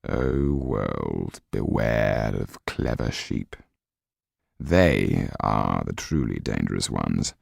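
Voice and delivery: low, slow, knowing inner musing; low sardonic thought, slow and quiet; Kaliowski's inner voice. A narrator's reading. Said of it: "Kaliowski gave a thin smile as he thought".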